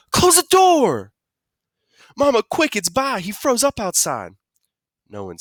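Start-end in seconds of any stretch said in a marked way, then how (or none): 0.1-1.1 high, loud drawn-out yell. A narrator's reading. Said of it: "Mom and Dad and Joey all yelled". 2.2-4.3 put-on high, alarmed child's call; urgent alarm. Child urgently calls for Mama.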